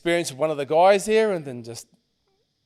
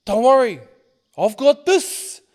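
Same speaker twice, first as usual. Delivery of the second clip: loud, high, slowing to a stretched finish; punchy acted-out bravado; bold reassurance, imitated; 5 semitones higher, 6 dB louder, slower than usual. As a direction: play a friend's reckless confidence. Friend pulls out a gun.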